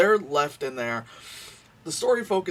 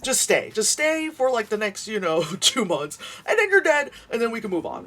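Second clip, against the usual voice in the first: mock blunt advice; 7 semitones higher, 4 dB louder than usual. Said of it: "I would just be like".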